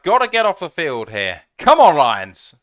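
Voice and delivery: loud, urgent fan urging the defense on; urgent hype. Real, not acted. Fan urging the Lions defense to stop third down, loud and urgent. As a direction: pump up your team at third down.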